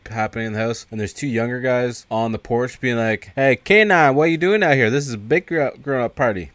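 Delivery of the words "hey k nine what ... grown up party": acted-out call, loud and raised. Two guys on the porch call to young boy, mock calling out. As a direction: holler to the kid, then ease off.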